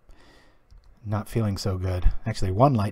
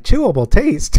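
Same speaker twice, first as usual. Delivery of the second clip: higher, louder mock disbelief; incredulous echo; 10 semitones higher, 8 dB louder than usual. Brother echoing the ad line back, mock incredulous. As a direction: repeat "Chewable taste?" as a baffled question. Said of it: "And he's like".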